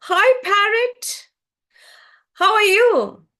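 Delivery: high, loud, drawn-out hail; happy greeting. A narrator's reading. Crow and swan find the parrot, hailing it happily. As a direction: sing out a delighted hello. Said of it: "they reach the parrot happily and they ask".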